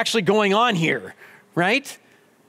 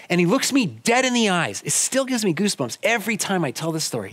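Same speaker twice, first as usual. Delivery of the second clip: normal talking voice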